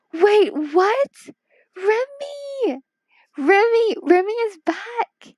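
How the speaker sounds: sudden high thrilled cries of a name; excited. Real, not acted. Streamer greeting returning viewer Remy, thrilled high squeal.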